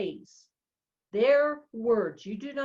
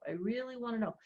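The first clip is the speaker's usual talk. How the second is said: quiet, earnest murmur to yourself; softer sincere inner voice; 8 dB quieter, much faster than usual. Shown as a demonstration. Coach showing the inner thought, softly and sincerely. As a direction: say it softly, truly meaning it. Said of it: "This is what you put for yourself".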